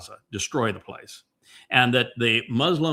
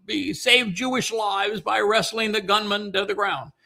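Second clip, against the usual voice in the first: mocking quote; 11 semitones higher than usual. Mocking critics who defend Islam, in a shrill high parody. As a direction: whine it like a smug know-it-all. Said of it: "want to say".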